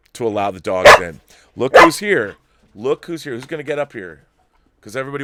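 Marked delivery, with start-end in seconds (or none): 1.6-3.3 excited greeting. Real, not acted. Dogs come in the open door.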